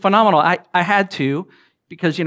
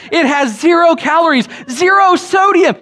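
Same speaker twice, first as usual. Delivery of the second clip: high, louder mock wonder at the label; mock amazement; 11 semitones higher, 5 dB louder than usual. Preacher reading the bottle, mock-amazed. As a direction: gush over the label with a laugh.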